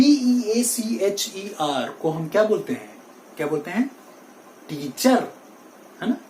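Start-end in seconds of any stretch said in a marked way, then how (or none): 4.7-5.3 टीचर as tee-char with the r sounded; wrong pronunciation. Shown as a demonstration. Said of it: "that is wrong". (टीचर का गलत उच्चारण, अंत में र)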